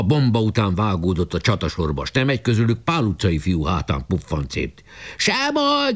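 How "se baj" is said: high cheerful cry; shouted. A narrator's reading. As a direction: shout it off lightly. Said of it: "kiáltották".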